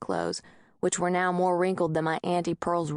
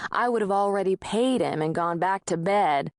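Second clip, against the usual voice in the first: ordinary delivery, nothing marked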